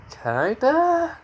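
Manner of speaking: very high questioning squeak; embarrassed, high rising answer; sheepish admission. Acted. Sheepishly admits to a crush.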